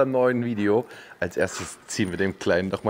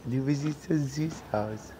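soft, slow tender coo; 3 semitones higher, 7 dB quieter, slower than usual. Real, not acted. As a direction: whisper sweetly to the foal. Soft admiring of foal.

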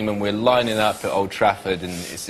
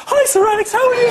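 far higher, louder squeaky voice; starstruck squeak; 7 dB louder, faster than usual. Retold or imitated. Co-star mimicking Jason greeting Sir Alex, in a much higher squeak. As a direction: squeak it like a panicked starstruck fan. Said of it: "And he goes".

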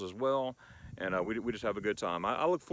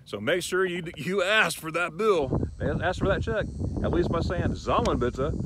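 louder, higher urging; emphatic advice; 6 semitones higher, 5 dB louder than usual. Real, not acted. Host insisting viewers ask for the check. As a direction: drive the point home. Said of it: "emphatic advice to viewers".

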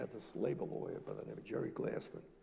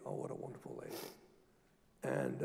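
ordinary talk, nothing marked

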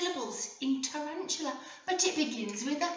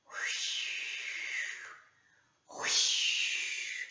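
whispered whoosh; 4 dB quieter than usual. Acted. Imitating a splashing water animal.

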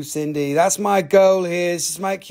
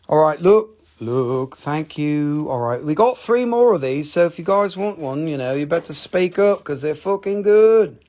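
usual speaking voice

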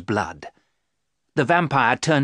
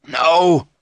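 loud, sharp refusal; firm cry; 6 semitones higher, 8 dB louder than usual. Acted. Van Helsing refusing Mina's despair, loud. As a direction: cut her off forcefully. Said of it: "Van Helsing cried".

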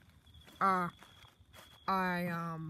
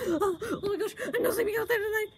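crying; 11 semitones higher, faster than usual. Acted. Scared Luigi whimpering.

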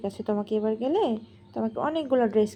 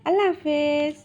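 slow model goodbye; 3 semitones higher, slower than usual. Shown as a demonstration. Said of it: "ভালো করে বলো".

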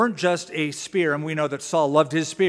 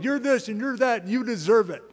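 cursing tirade; 6 semitones higher than usual. Retold or imitated. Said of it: "he just unloaded".

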